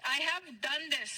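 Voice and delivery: the full question drawn out slowly; teacher corrects the mistake, word by word; slow correct model. Shown as a demonstration. Teacher gives students the right form slowly. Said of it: "कैसे बोलेंगे".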